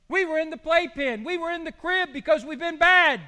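loud, high preaching peak; preaching at full force. Acted. Preacher telling the church we were bad, loud and high. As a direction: ring it out over the congregation.

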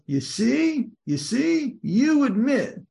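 high, lilting "gotcha" quote; high-pitched, insistent retold argument; mock triumphant point-proving. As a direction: imitate someone pressing home an admission, pitch swooping up. Voices the author triumphantly proving point.

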